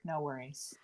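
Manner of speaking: breathy cool aside; whispered. Retold or imitated. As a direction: drop to a whisper, unbothered. Cocky hero quote ending.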